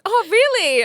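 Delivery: high, loud imitation of surprise; mock surprise. Partner hearing of her launched event, mock surprised. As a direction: play the oblivious partner's surprise. Partner's oblivious reaction acted out.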